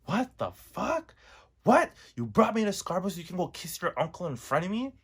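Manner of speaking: imitating a high, outraged yell; retold scream. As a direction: shout it at the guy, shocked and high. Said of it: "I was screaming".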